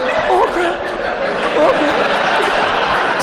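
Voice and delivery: very high, squeaky dread; mock panic. Pretender being dragged to a real interview, mock panicked. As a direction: squeak it out as comic alarm.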